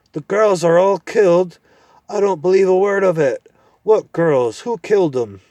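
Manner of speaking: high, loud grumbling dwarf; indignant deaf dwarf. Acted. Deaf dwarf blustering at the owl. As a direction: huff and bluster at the nonsense.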